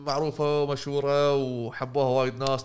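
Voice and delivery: measured broadcast tone; news anchor read. Pretend. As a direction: announce it like the nine o'clock news. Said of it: "هذه نشرة التاسعة".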